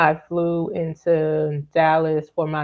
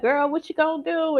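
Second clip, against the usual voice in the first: high, fast playful friend voice; quick and bright like teasing a birthday girl; imitating her friend; 10 semitones higher, faster than usual. Friend retelling birthday texts from Lynn, high and fast. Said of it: "And she'd be like".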